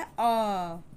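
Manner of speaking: "अ" held long as a drawn-out aah; sustained, slow "अ"; long A sound. Shown as a demonstration. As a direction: stretch "अ" slowly for learners. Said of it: "long sound".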